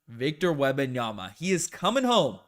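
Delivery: loud, high hype call; excited announcement. Real, not acted. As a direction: proclaim it like a big homecoming. Calls his hoped-for draft pick.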